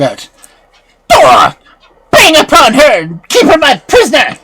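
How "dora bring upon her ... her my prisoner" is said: high, shouted witch's command; furious witch. A narrator's reading. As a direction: shout the order in a choking rage. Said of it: "so angry she nearly choked".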